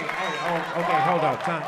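ordinary talk

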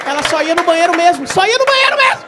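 loud, high exasperated yell; shouting the disappointment; frustrated shout. Retold or imitated. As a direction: yell the letdown. Punchline shouted, big pitch jump.

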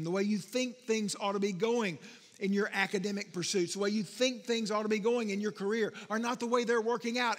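usual speaking voice

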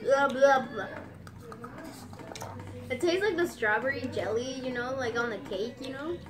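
speaking with food in mouth; chewing candy while talking. Real, not acted. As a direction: mouth partly full. Seen 1: Woman put candy in mouth earlier; lips pursed, chewing while talking.

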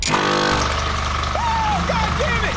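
loud frightened yell; startled shout, high and loud; scared horror game streamer. Real, not acted.

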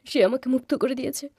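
whispery, tear-choked confession; tearful. Acted. Freed servant explains why she is crying, tearful. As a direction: force the words out through tears. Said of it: "তুমি কাঁদছো কেন".

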